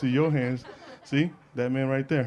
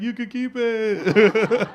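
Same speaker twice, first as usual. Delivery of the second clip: high, very drawn-out mocking echo; mocking refusal; 7 semitones higher, 4 dB louder, much slower than usual. Teen turning down the family's dish, mocking. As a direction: stretch it out high, copying their delight.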